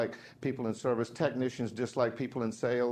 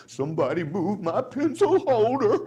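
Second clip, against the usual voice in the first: whiny petty coworker; 9 semitones higher, 7 dB louder than usual. Retold or imitated. Mocks petty coworker's complaint.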